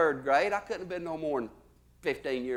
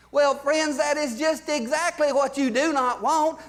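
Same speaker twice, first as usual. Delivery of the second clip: pitchman voice; 8 semitones higher than usual. Acted. Salesman pitch rising.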